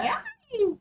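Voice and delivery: high sharp scared cry; startled yelp. Real, not acted. Player spooked by enemies, high yelp. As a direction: yelp in fright.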